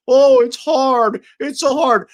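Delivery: high, slow, whiny mimicry of a complainer; mock crybaby whine. Streamer mocks complaining viewers with a slow whiny crybaby voice. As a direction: drag it out like a whining baby. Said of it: "bitch asses crying".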